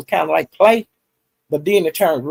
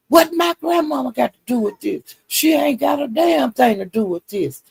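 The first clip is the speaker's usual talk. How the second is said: high, offended protest; retold outrage at a much higher pitch; mock indignation; 8 semitones higher than usual. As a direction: imitate an offended comeback, pitched up. Retold indignant reply, Duck.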